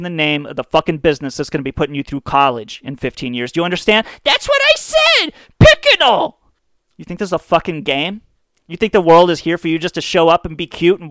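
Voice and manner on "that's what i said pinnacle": very high, loud, slow squeaky "Pickinal"; smug kid voice. Retold or imitated. Ad kid insisting he said it right, shrill kid squeak. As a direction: squeal it like a smug little brat. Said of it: "kids ... with the high voices".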